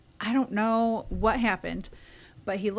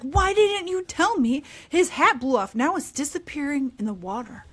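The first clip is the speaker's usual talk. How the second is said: higher, louder mock telling-off; a hint of an angry husband; 5 semitones higher, 3 dB louder than usual. Husband snapping at the girls about the lost hat, mock angry. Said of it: "he is pissed".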